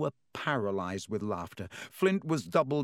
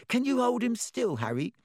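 put-on a much higher, breathless young voice; eager boy; 11 semitones higher than usual. Colin chasing Harry, eager and high-pitched. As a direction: pester him like an excited fan.

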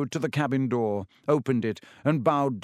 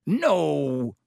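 higher, drawn-out growl of refusal; 4 semitones higher, 4 dB louder than usual. A narrator's reading. Hagrid refuses to lose Dumbledore, growling. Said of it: "growled Hagrid".

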